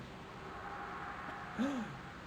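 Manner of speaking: sudden breathy gasp of shock; sharp gasp. Real, not acted. Streamer gasping at a missed jump, sharp and airy. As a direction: gasp as the character falls.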